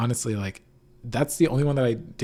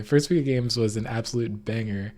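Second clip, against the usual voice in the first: usual speaking voice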